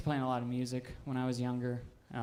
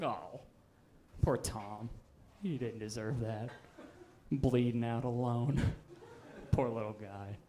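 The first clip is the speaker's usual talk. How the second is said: mock pity; 5 semitones lower, 6 dB quieter than usual. Mourners pity him.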